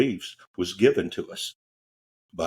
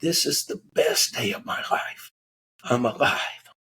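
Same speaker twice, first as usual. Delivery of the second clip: breathless, half-whispered tearful joy; crying with relief; 3 semitones higher than usual. Retold or imitated. Crash survivor weeping with relief, tearful whisper. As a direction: sob out the relief of being alive. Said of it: "he's crying hysterically".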